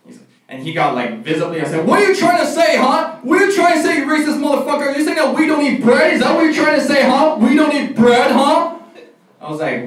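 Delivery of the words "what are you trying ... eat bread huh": high-pitched, indignant mock tirade; worked-up rant. Offended friend accusing comedian of racism, mock-furious. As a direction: imitate an offended friend firing off accusing questions. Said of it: "bro, calm down".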